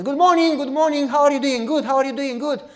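high, loud, sing-song hello; mock bright neighborly hello; cheery greeting. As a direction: chirp a hollow cheerful greeting. Imitating cheery shallow greeting.

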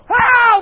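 very high, loud cry; patient's yell. Retold or imitated. Patient yelling as guards walk in, loud cry. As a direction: burst out in a mimicked yell.

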